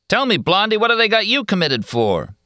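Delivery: high, sneering heckler voice; taunting loafer. A narrator's reading. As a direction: needle her with a leering question.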